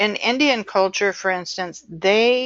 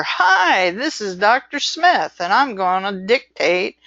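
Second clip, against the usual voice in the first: barreling greeting. Retold or imitated. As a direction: boom out a hearty hello like a pushy dictator. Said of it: "They don't barrel out there".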